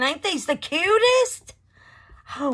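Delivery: high, loud gushing squeal; a high squeal of delight. Real, not acted. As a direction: gush over how cute they are. Gushing over cute molds.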